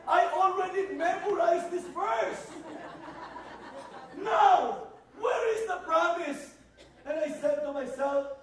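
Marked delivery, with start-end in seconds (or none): 0.1-6.6 high, loud exasperated outburst with a laugh; preacher mocking his own younger anger at the verse; laughing frustration. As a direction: vent your frustration, half laughing. Angry retelling, laughing.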